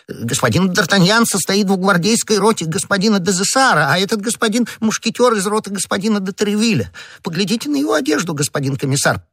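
high-pitched, insistent torrent of argument; agitated crying out. A narrator's reading. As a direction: gabble your proof frantically, pitched high. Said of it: "вскричал Бонасье".

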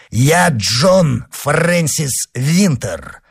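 high, slow, ringing self-announcement; proclaiming. Acted. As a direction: draw out each name as a revelation. Avenger reveals his name.

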